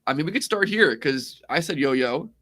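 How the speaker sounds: ordinary delivery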